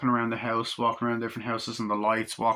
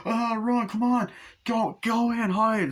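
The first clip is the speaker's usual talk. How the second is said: high, urgent cries; hammed-up frantic orders; mock panicked urging; 10 semitones higher than usual. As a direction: imitate sending children running to hide. Victim urges children to flee.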